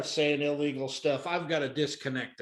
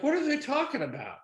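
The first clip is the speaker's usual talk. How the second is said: higher-pitched baffled imitation; mock bewilderment, pitched up; confused; 9 semitones higher than usual. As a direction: ask it as the baffled bystander. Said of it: "This other guy's confused".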